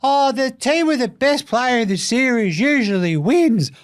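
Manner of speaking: high, slow mocking whine of a pundit. Host mocking a pundit's cliché in a high whiny voice. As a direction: parrot the boring pundit sarcastically.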